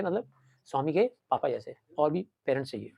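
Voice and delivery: ordinary delivery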